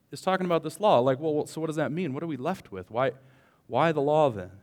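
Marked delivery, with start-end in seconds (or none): none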